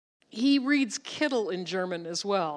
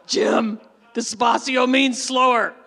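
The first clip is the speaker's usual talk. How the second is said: laughing; 7 dB louder than usual. Real, not acted. Correcting Jim, laughing.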